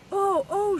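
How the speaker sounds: high, bright cry of surprise from a mother bird; excited mother bird. Acted. Mother bird feeling her egg jump, excited and high. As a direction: gasp it out as the startled mother.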